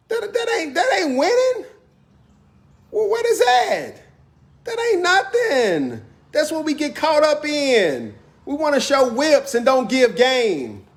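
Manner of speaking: high, heated preaching rant; fired-up outrage at the community; getting hyped. Real, not acted. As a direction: rise way up and push the point. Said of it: "I'm getting hyped".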